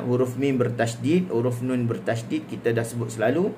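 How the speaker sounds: ordinary talk, nothing marked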